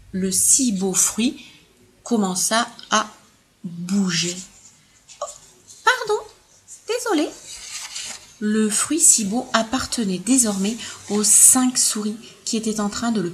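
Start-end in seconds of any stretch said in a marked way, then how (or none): 5.2-7.3 high, tiny mouse voice; squeaky mouse apology. Acted. Mouse apologizing to the elephants, squeaky. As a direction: squeak it out politely.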